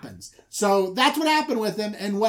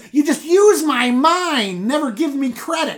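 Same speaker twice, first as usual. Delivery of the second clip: high, loud impassioned rant; ranting Jacob; 8 semitones higher, 7 dB louder than usual. Retold or imitated. Jacob ranting at Laban about his hard work, loud. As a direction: build the grievance to a shout.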